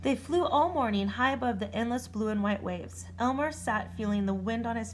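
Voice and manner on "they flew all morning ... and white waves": ordinary delivery, nothing marked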